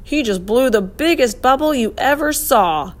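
lightly acted talking with full mouth; mouth-full mumble. A narrator's reading. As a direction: mumble it excitedly through gum. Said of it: "with his mouth full, he mumbled".

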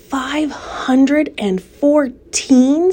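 slow, drawn-out amazed question; astonished question. Acted. Pig amazed at spider's egg count, slow astonished question. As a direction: stretch out "Five hundred and fourteen?" in wonder.

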